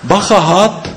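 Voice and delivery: warning. Acted. Bird warns of snake.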